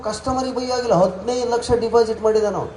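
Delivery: ordinary delivery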